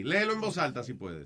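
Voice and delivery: soft, understated instruction; hushed, casual prompt; low-key request. Real, not acted. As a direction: ask him quietly to read. First, low request to read aloud.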